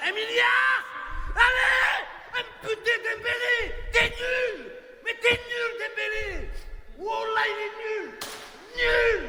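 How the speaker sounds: high, furious screaming; furious yelling. Real, not acted. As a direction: scream insults at the player. Screaming at Dembélé.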